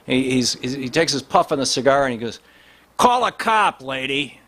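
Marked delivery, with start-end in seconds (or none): 3.0-4.4 Cool Hand Luke. Retold or imitated. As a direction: sneer it out like an old-movie tough guy exhaling cigar smoke. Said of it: "like Cool Hand Luke or something".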